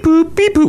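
high electronic beep-boop imitation; machine beeps. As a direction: chirp it like a pay machine.

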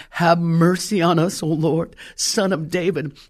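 ordinary talk

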